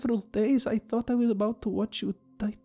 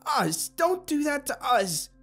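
very high, slow, indignant yell; yelled correction; 3 dB louder, slower than usual. A narrator's reading. Abigail's yelling continues, self-correcting.

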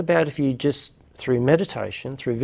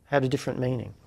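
normal talking voice

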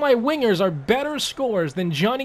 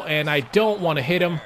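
ordinary delivery

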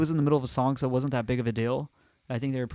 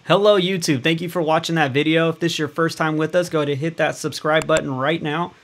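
upbeat host sign-off; YouTube outro; 3 semitones higher, 4 dB louder than usual. Acted. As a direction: pitch the subscribe button cheerfully. Channel outro to viewers.

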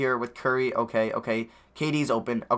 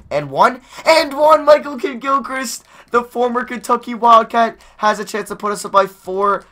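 booming sportscaster call; announcer voice; 10 semitones higher, 7 dB louder than usual. Pretend. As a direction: call it like a TV play-by-play. Mock announcer call of and-one.

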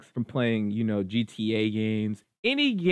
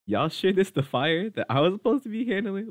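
incredulous, higher-pitched question; mock disbelief; 6 semitones higher than usual. Grandson with small extinguisher facing a huge fire, incredulous.

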